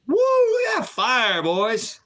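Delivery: high, excited whoop and cheer. Real, not acted. Host cheering the fire to the boys, excited whoop. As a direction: let out a high hyped-up cheer.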